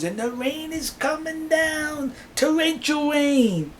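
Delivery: high, slow, drawn-out storytelling; dramatic drawl. Retold or imitated. Cab driver describes driving through a storm, dramatically drawn out. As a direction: stretch the words out like an endless downpour.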